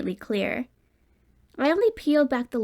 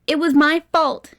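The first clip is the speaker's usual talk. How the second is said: higher, louder outburst; sudden exclamation; 6 semitones higher, 8 dB louder than usual. A narrator's reading. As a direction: blurt out the guilt. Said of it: "he suddenly exclaimed".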